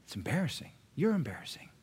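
mock whisper of an embarrassed relative; acted, whispered family reproach; hushed scolding. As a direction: say it very softly so others won't hear.

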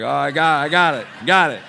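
loud, quick got-it; hurried loud agreement. Retold or imitated. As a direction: blurt it out to calm him. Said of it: "I was like".

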